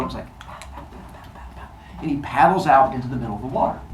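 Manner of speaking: plain reading voice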